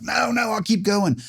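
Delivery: high, drawn-out mock pleading; fighting protest. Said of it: "I'm fighting".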